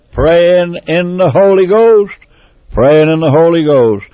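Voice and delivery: loud revival preaching; preaching at full force. Acted. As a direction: drive it home from the pulpit. Preaching builds to a loud peak.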